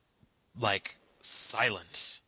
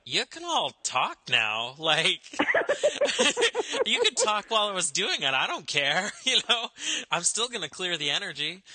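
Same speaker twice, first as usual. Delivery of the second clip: higher and laughing, telling the room to relax; laughing reassurance; 8 semitones higher than usual. Retold or imitated. Telling the silent ritual group they may talk, laughing. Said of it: "and I was like".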